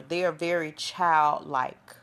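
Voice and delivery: put-on slow, drawn-out "childlike"; stretched out. Shown as a demonstration. Host stressing Aries are childlike, slow and stretched. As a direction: stretch out "They're very childlike" deliberately. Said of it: "let me stretch that".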